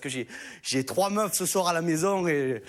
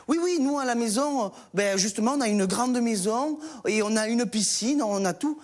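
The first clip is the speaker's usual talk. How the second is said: put-on high, eager child voice; a kid showing off; boasting kid; 5 semitones higher than usual. Pupil boasts to the teacher in a high eager voice.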